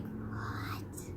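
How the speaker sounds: stunned whispered what; whispered disbelief. Retold or imitated. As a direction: breathe it out in shock. Reacting to deleted account.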